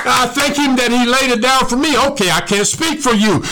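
high, fervent preaching lifting into praise; full-force preaching. Acted. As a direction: preach it out with rising fire.

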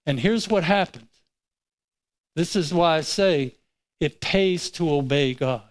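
ordinary talk, nothing marked

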